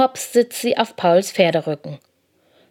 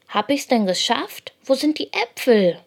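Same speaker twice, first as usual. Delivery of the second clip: mildly plucky, higher little-hare voice; brave; 6 semitones higher than usual. A narrator's reading. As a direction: ask with a touch of bravery. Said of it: "fragt Lina tapfer".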